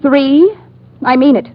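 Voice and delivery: rising countdown threat. Acted. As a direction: shout the number to scare him.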